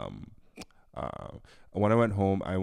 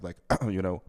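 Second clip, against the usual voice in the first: usual speaking voice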